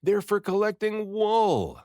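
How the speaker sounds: high, loud shout landing on wool; joyful shout to the sheep; excited shout. A narrator's reading. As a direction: call it out across the meadow. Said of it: "I shouted to them".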